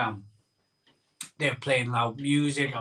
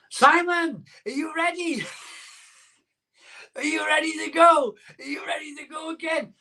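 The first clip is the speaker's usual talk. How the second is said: imitating friend Michael; 14 semitones higher than usual. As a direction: squeal it like an overexcited mate calling out. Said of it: "my good friend Michael ... was like".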